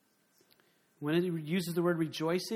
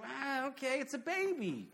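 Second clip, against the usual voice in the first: higher, deadpan and dismissive; mock unimpressed bystander; 8 semitones higher than usual. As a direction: shrug it off like a bored onlooker. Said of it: "everyone else is like".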